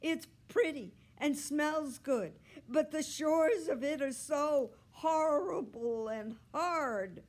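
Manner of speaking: loud, high, complaining cat; sustained high-pitched indignant yowl. A narrator's reading. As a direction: wail out your tale of woe. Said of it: "Are you ever a yowler?".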